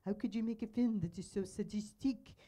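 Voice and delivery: bad French accent. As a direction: imitate an indignant viewer in bad French accent. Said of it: "imitate a very bad French accent".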